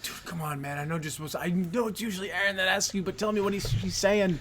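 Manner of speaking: very soft, secretive aside; hushed pleading. Retold or imitated. Moviegoer asking a friend to translate the Spanish, hushed. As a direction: keep it right down, as if nudging a friend mid-film.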